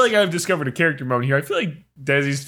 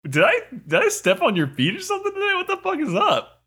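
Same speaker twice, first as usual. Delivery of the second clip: mock offended. Retold confrontation with friend.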